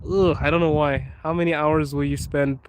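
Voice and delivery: usual speaking voice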